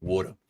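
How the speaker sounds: low, soft "Water" as "waw-tuh", no final R; New York pronunciation. Shown as a demonstration. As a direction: murmur "Water" New York style, R dropped. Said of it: "I say water".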